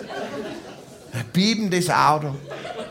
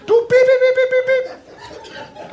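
very high, long drawn-out beeps; high censor bleeps over an insult, ending in laughter; imitated censor beep; much slower than usual. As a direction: bleep out a swear word. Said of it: "da soll das piepen".

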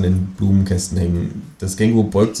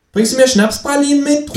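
much higher, louder kangaroo call; 5 dB louder than usual. Acted. Kangaroo calls down to the narrator in the yard, high and loud. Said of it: "ruft das Känguru".

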